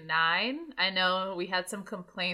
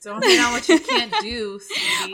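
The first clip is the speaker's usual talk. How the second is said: sassy; 7 semitones higher, 5 dB louder than usual. Acted. Teasing co-host about accents.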